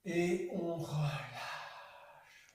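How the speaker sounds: whispered on a long slow out-breath; airy sigh of release; breathy release. Shown as a demonstration. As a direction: let the words go out on the breath. Yoga release on the exhale.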